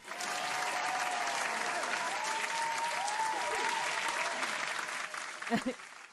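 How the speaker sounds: long high-pitched giggling laugh. Real, not acted. Streamer laughing at her own gushing: long high giggle. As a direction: break into a long squeaky laugh.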